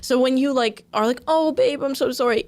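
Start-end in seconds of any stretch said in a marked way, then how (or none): 1.3-2.4 higher-pitched, taunting imitation of an apology; mock-sincere apology. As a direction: imitate a sarcastic, overdone sorry. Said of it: "sound like you're chronically sarcastic or you're just taunting".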